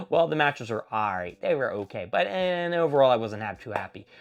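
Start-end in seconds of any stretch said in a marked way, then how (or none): none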